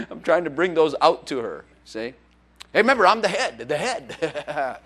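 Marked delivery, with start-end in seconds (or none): 2.7-3.7 mock assertive. Husband asserting to wife. 3.7-4.8 laughing. Retold or imitated. Trails into laugh.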